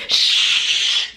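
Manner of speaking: breathy, sharp shush. Retold or imitated. Laboring mom shushing talkers in the room. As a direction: silence the room. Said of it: "if anyone would talk in the room".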